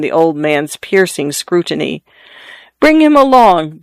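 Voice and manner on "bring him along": short, high and sharp command; curt. A narrator's reading. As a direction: clip the order off, higher and louder. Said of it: "the married lady said curtly".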